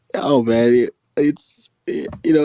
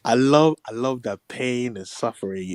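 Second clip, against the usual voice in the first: usual speaking voice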